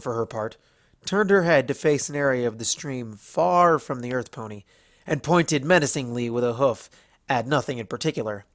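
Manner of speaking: plain reading voice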